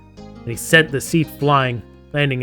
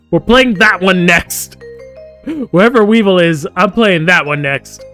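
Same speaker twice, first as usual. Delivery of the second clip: excited declaration; 5 semitones higher, 7 dB louder than usual. Real, not acted. Gamer learning of a Weevil romance, loud excited vow. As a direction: proclaim it loudly, stretching the words.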